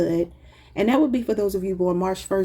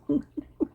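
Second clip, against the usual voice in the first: high, helpless giggle; real cracking-up laugh; 7 semitones higher, 4 dB quieter than usual. Real, not acted. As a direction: laugh you can't hold back. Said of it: "I'm sorry for laughing".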